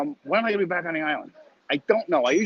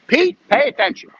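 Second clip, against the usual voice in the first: sharp high louder call; 7 dB louder than usual. Real, not acted. Telling Pete to pay attention, high and loud. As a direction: call out to get Pete's attention.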